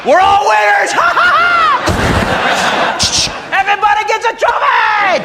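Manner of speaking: high manic screaming with crazy laughter; unhinged shooter kid. Acted. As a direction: scream it like a kid gone mad. Voicing the unhinged kid with shotgun.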